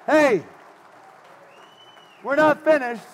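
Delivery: high, loud shout to a crowd; calling out. Real, not acted. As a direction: holler to get everyone's attention back. Calling crowd back to attention.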